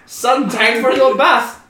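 singled-out slow call turning into high shriek; everyday dad voice leaping to comic horror; dad's mock shock. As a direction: call son slowly, then yelp in disbelief. Acting dad walking in.

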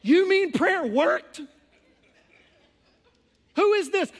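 high, loud, slow laughing disbelief; mock disbelief. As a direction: act stunned that prayer worked. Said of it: "It's like".

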